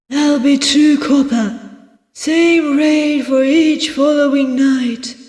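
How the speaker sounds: high innkeeper voice quoting a price; businesslike innkeeper. Acted. Voices innkeeper Barrow in a story.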